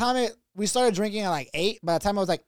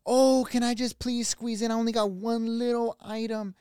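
put-on higher, wheedling old lady; imitated polite old-lady plea; pleading older lady; 4 semitones higher than usual. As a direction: ask sweetly for a small favor. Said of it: "this older Mexican lady comes up".

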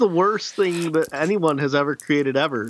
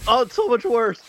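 mouth burning from spicy food; 7 semitones higher than usual. Real, not acted. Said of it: "my whole mouth is on fire".

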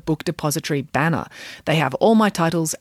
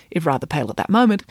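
ordinary delivery

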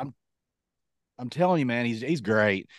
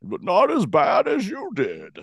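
higher, slow movie-villain impression; Bane; 6 semitones higher, slower than usual. Villain answering the hero, slow put-on high voice. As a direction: put on an odd, drawn-out villain voice. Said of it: "I loved his voice".

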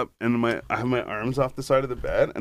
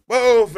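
sudden yelp; 12 semitones higher, 9 dB louder than usual. Retold or imitated. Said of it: "I'm like".

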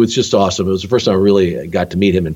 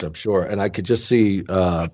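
ordinary talk, nothing marked

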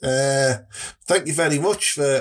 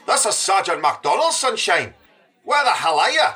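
demanding police sergeant; 5 semitones higher than usual. Acted.

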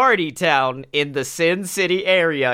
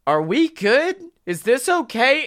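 higher, uneasy mock questioning; nervous checking; 7 semitones higher than usual. Riders asking as the driver gets stoned, nervous checking. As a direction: play a mildly worried passenger.

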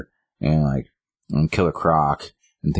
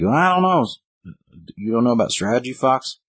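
much higher, sing-song mockery; mocking tease; 7 semitones higher than usual. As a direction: tease him like he's clueless. Grifter mocking Luke.

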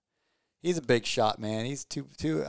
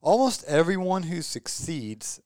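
a slow, half-hearted stab at a Southern drawl, pitched up; attempted Southern drawl; 6 semitones higher, slower than usual. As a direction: start a Southern drawl, slow and lifted, then give up on it. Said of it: "I can't do his sweet Southern drawl accent".

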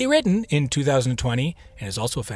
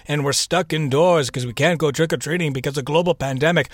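ordinary talk

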